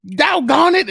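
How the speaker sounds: high, loud fired-up yell of Phillip Rivers; fiery quarterback yelling. Retold or imitated. Imitating Phillip Rivers yelling on the field, high, loud and fired up. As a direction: bark it like a fired-up quarterback on the sideline. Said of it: "Like, he would be".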